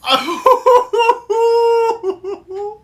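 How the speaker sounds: high, loud squealing laugh; helpless cackle at the mirror; shrieking laugh. Real, not acted. Laughing at her makeup look.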